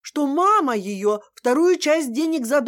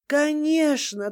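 usual speaking voice

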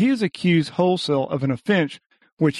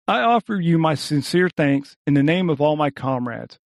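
ordinary delivery

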